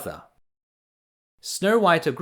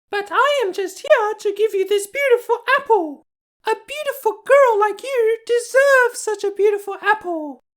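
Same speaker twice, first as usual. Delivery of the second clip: put-on very high, coaxing old crone voice; the wicked queen hiding as a sweet old lady; disguised old woman; 4 dB louder than usual. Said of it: "said the old woman".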